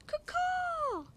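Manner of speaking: high squawking bird call, kaw kaw; hawk cry. Acted. Child reader squawks the mama hawk's call. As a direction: screech it like a hawk.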